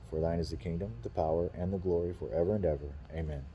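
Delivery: soft, steady prayer recitation; recited prayer. Acted. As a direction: say the Lord's Prayer quietly to yourself.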